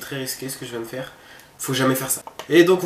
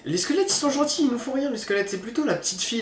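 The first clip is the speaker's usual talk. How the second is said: high-pitched; 10 semitones higher than usual. Real, not acted. Reassuring about skeletons.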